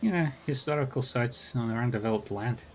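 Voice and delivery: lilting Irish brogue, easygoing; a friendly Irish arcade regular, talked lightly; lyrical Irish accent. Acted. GM voices Rainbow backstory.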